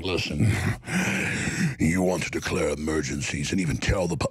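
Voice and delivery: Nancy Pelosi impression. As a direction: growl it out like a sinister politician. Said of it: "Just like".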